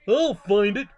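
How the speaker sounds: loud, rising vow; determined cry. A narrator's reading. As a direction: cry it out with resolve. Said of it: "cried Horton".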